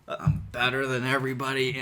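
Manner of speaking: dominant boast. Retold or imitated. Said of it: "assertive, dominant".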